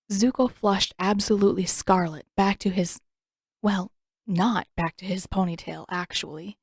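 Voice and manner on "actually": ordinary delivery, nothing marked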